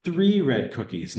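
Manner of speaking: slow, emphatic "Three"; stress on three. Shown as a demonstration. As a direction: correct someone by leaning on "Three". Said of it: "You're, you're emphasizing".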